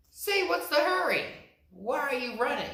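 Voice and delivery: high, quick curious little rabbit voice; a small rabbit asking fast; high, fast rabbit question. Acted.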